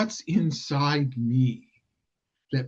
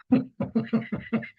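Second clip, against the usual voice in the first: short high giggle; real high giggle; amused laugh; 8 semitones higher than usual. Real, not acted. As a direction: laugh at the cheeky phrase. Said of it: "I'm laughing because".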